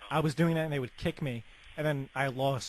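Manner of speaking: ordinary delivery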